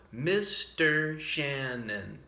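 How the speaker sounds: "Mister Shannon" slowly, syllable by syllable; syllables spelled out. Shown as a demonstration. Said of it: "has four syllables".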